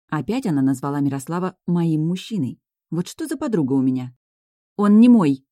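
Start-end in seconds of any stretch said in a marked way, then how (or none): 4.8-5.4 loud, higher protest; exclaiming. A narrator's reading. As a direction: burst out in denial. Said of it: "воскликнула я". (громкое возмущённое восклицание)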